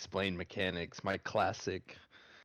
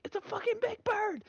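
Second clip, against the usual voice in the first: very high, rushed imitation shriek; mock panicked cry; faster than usual. Friend retells teammate seeing the boss bird, high rushed mock squeal. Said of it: "and she was like".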